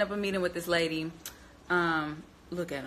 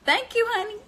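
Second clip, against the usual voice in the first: a sweet, much higher voice for the overheated phone; the phone talking; 12 semitones higher than usual. Pretend. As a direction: play the phone, sugary and high. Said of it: "my phone was like".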